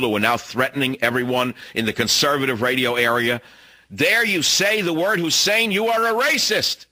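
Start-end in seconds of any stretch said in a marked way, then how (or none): 3.9-6.9 mocking voice. Mocking critics who scold him.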